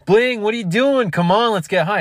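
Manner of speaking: high, loud buddy voice egging him on; pushy friend. Retold or imitated. Friend outside urging him to come get high. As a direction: hype up your buddy to party.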